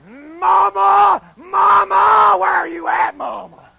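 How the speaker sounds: very loud, high cry for mama; mock terrified wail. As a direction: wail for your mother in comic panic. Frightened recruit calling for his mother.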